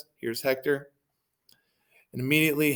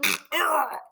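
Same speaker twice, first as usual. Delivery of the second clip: high choked-off cry; hammed-up strangled dying noise; death gurgle; 3 dB louder than usual. Greek killed by Hector mid-plea, choked death cry.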